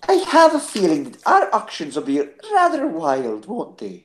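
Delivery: a hint of a genteel old nanny; Mrs. Doubtfire. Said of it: "a Missus Doubtfire impression".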